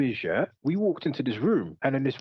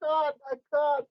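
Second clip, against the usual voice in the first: laughing to tears; 10 semitones higher than usual. Real, not acted. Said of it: "I'm tearing up".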